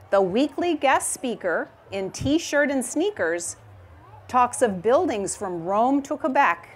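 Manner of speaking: reading a rhyming picture book aloud; gentle storytime reading voice; story narration. A narrator's reading. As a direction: read it like storytime for a class.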